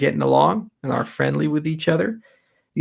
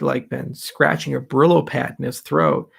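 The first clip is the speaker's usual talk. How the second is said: normal talking voice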